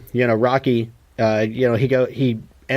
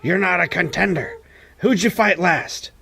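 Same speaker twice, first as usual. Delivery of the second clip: higher-pitched, slowed mock Mickey scolding; gruff old trainer; 6 semitones higher than usual. Mickey scolding Rocky, retold.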